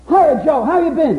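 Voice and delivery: put-on high, loud hearty hello to an old friend; greeting a friend back from vacation, loud and bright; friendly greeting. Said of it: "And you say".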